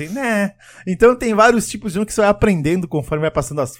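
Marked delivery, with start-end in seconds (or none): 0.1-0.5 high, lazy drawn-out refusal; can't-be-bothered, stretched refusal. Shown as a demonstration. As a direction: let the no slide out as an idle whine. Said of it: "o não mais preguiçoso".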